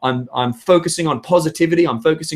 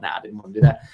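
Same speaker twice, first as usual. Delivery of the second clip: ordinary delivery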